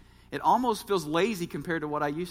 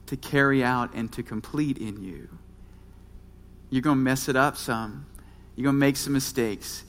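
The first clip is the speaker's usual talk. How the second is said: pastoral reassurance; 7 semitones lower than usual. Real, not acted. Pastor encouraging twenty-somethings to give themselves a break, calm and low. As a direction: reassure them gently, keeping the voice low.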